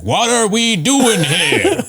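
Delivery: high, slow, laughing bewildered line; mock Daniel Plainview. Imagining Plainview under a napkin, high and slow. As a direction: drawl it out high, baffled. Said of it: "he was like".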